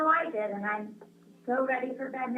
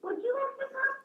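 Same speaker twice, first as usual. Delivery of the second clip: a much higher, put-on animal voice for the forest walk; high character voice; 13 semitones higher than usual. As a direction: pitch it way up like a storybook creature. Animal character speaks in story.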